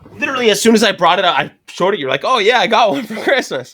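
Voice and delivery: high, loud, quick burst; animated mock-friend reaction; excited retelling. Retold excited reaction to a gift.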